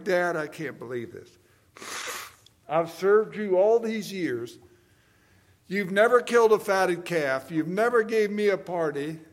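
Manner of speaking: put-on loud, indignant older son protesting to his father; furious elder brother. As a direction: rail at Dad with years of resentment. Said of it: "Elder brother was furious".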